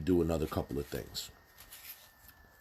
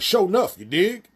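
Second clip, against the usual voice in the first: much higher, louder hammed-up seventies jive; jive street talk; 11 semitones higher, 8 dB louder than usual. Mocking how a governor should not talk, loud hammed-up jive. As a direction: camp it up like an old blaxploitation hustler. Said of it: "be like".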